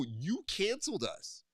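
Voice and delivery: high, slow, pointed comeback; exaggerated high-pitched indignation; retold indignant retort. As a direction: throw the blame back in a high, drawn-out voice. Retold quarrel with company.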